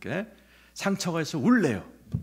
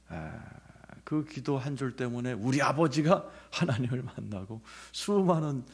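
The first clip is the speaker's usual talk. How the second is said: ordinary delivery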